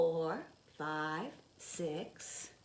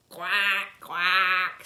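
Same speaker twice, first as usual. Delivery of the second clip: loud quacks; a big bold duck call; mother duck quack; 11 dB louder than usual. Acted. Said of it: "says the mother duck".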